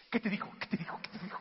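quick repeated question, fading away; hushed eager questioning. Retold or imitated. Family prying after the girl leaves, hushed and eager. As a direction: pester him under your breath, trailing off.

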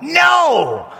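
sudden high shout; yelled out. Retold or imitated. Seminarians shouting back at the reader, loud. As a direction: blurt out a loud no. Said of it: "we all yelled out".

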